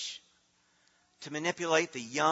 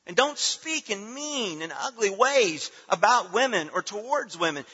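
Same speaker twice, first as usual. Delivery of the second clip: higher, louder, driving sermon voice; preaching at full force; 6 semitones higher, 6 dB louder than usual. Acted. Pastor exhorting the congregation, preaching hard. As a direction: press each point home from the pulpit.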